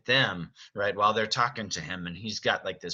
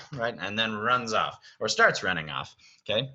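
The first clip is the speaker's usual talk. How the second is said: usual speaking voice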